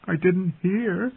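high, slow, tearful protest; weeping. A narrator's reading. Bran to the gargoyles closing in on him, weeping. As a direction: plead in a thin high pitch, drawn out. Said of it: "he wept as they came closer".

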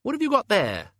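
usual speaking voice

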